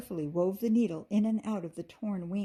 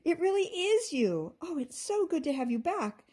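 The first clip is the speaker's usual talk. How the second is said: high and loud with delight; overjoyed to see her sister; happy calling; 9 semitones higher, 4 dB louder than usual. A narrator's reading. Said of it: "Amber called happily".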